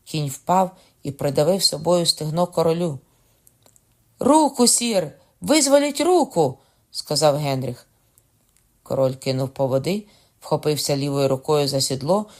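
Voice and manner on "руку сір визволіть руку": very high, loud warning; strained-high, pressing call; urgent shout. A narrator's reading. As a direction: cry out to save the rider. Urgent cry to fallen king.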